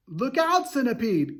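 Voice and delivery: alarmed cry. A narrator's reading. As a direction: yell a sudden warning. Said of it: "cried James".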